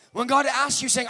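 usual speaking voice

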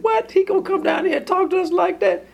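very high, quick, incredulous outburst; indignant disbelief. Acted. Tenants in parable object to the master's servant, indignant. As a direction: shoot the voice way up in mock outrage.

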